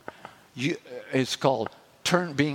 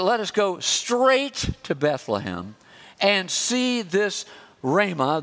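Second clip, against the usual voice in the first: ordinary delivery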